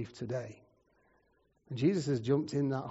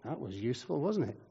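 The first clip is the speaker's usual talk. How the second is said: softer, understated wry aside; dry sarcasm; 3 semitones lower, 7 dB quieter than usual. Real, not acted. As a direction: drop it down into dry irony. Wry verdict on useless doctor.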